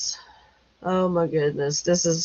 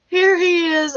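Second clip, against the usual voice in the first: laughing; 10 semitones higher than usual. Real, not acted. Laughing at tractor chase photo.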